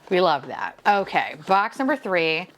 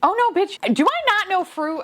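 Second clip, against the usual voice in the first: mock outrage; 11 semitones higher, 4 dB louder than usual. Real, not acted. Realizes she misidentified the fruit.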